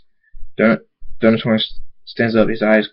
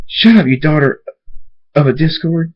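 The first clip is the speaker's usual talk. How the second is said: raised, higher angry voice; angry insult; 6 semitones higher, 4 dB louder than usual. Acted. Dave silencing the dark pony, angry raised voice. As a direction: hurl it loud and high.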